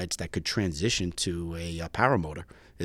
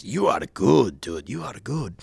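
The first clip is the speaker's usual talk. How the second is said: put-on heavy Greek accent; 2 semitones higher, slower than usual. Instructor brushing off his nervous student's question, heavy Greek accent. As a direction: imitate a breezy Greek instructor. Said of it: "in a very heavy accent".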